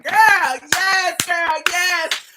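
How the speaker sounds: loud, high hype shout; mock cheering. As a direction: cheer her on loudly, hammed-up. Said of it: "I was like".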